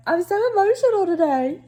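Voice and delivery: high, hammed-up emotional whine; mock emotional. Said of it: "and I'm like".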